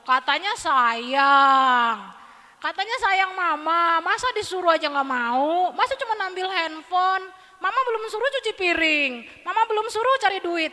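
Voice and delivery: put-on guilt-trip the child; scolding parent. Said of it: "Pasti ngomongnya gini". (ibu memarahi anak dengan keras, menyindir)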